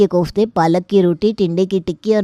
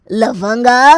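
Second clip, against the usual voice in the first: affronted echo; 4 semitones higher, 5 dB louder, much slower than usual. Acted. Uncle overhears himself called a lout.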